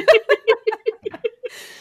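a loud, high laugh bursting out; high burst of laughter. Real, not acted. Promising the friends she's almost done, laughing.

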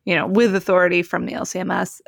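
ordinary talk

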